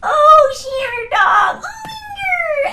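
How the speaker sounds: put-on high, loud, slow gushing baby talk to a dog; hugging the dog in silly baby talk. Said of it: "and I'd go".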